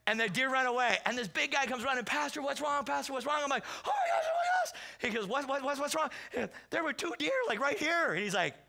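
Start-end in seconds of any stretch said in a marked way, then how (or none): 2.0-3.4 mock alarm. Big guy comes running, alarmed. 3.8-4.7 very high squealed panic; mock hysteria. Shaken pastor answers his hunting buddy, mock hysteria. Acts panic after screaming at deer. 5.3-8.1 high-pitched frantic exchange; mock panic. Hunters talk right after the deer scare, mock panic.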